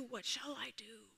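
voiceless, panicked whisper; scared whisper to himself; frightened whisper. Acted. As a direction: breathe it like a terrified cub. Terrified Simba voiced.

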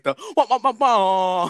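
muffled wah wah talk. Shown as a demonstration. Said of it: "like, um, horn".

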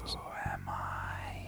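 slow, breathy spectral whisper; low eerie whisper, drawn out; whispering ghost. Acted. Ghost answers Lilith's question with one of its own, eerie whisper.

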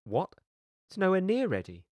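shocked protest. A narrator's reading. Lorna hearing the garden will open, shocked protest. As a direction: burst out in alarm at the news. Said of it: "Lorna choked and had to".

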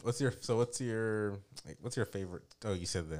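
slow, hazy and high; stoned. Real, not acted. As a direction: drift through it, a bit stoned. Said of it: "I'm sorry, I'm high".

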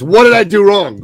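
high, loud, comic alarm; mock panic. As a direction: blurt it out like a panicked husband. Mock panic at a woman crying.

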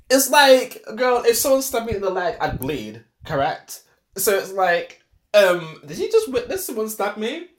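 put-on indignant girlfriend rant; incredulous outburst. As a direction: hit the absurdity hard, almost laughing. Mock confronting a bystander.

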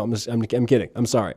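ordinary delivery

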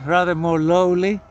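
normal talking voice

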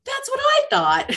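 cheeky. Retold or imitated. Cheeky reply to the celebrity.

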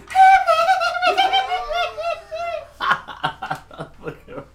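long, high cackle; demonstrated silly laugh. Shown as a demonstration. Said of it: "You're gonna sound like this".

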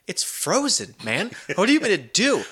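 rising, incredulous "it's frozen"; protesting a frozen drink in disbelief; mock protest. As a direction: whine that it can't be done. Retelling his own protest.

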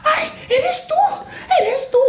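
very high squealing mock delight; imitating excited fan. Retelling the video, a lady squeals at the star. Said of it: "llega otra señora y dice".

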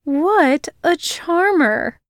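slow, dry sarcasm; drawn out and unimpressed; girl mocking his snarl, dryly. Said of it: "she said dryly".

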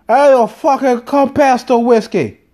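high, loud, slow hammed-up Scottish accent; mock Scottish accent. Showing off accents to his wife, loud mock Scottish. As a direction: bellow it like a badly faked Scotsman.

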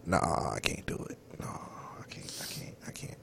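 fading into a near-voiceless whisper; hushed, helpless refusal trailing to breath; overwhelmed refusal. Real, not acted. Host can't handle a partner crying, overwhelmed whisper.

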